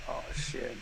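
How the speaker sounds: low, soft deflated curse; dismayed reaction. Retold or imitated. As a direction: mutter it quietly, heart sinking. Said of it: "I went like that".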